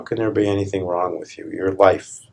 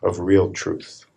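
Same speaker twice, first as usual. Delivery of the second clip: calm. Real, not acted. Slow, soft opening reflection.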